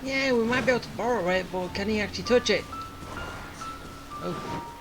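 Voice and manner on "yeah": ordinary delivery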